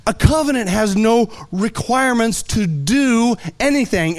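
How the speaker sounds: ordinary talk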